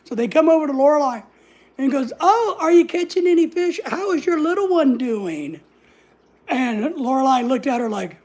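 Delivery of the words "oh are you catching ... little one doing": mock sugary busybody voice, pitched higher; fake-sweet prying lady; nosy busybody. Stranger asking about the baby, mock sugary busybody.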